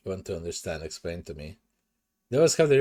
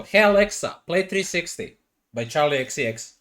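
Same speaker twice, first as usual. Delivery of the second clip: calling out to Alexa; 5 semitones higher, 4 dB louder than usual. Real, not acted. Calling to Alexa across house.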